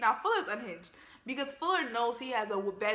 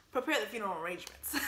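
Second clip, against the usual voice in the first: normal talking voice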